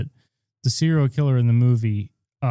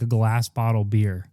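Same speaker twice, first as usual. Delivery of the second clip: ordinary delivery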